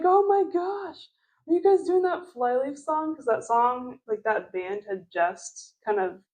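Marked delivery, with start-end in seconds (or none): 0.0-3.1 put-on excited kids.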